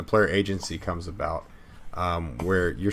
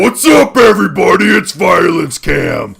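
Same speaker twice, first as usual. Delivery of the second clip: hype host shout; 10 semitones higher, 13 dB louder than usual. Acted. Hosts Violence Cam persona.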